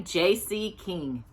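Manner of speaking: ordinary delivery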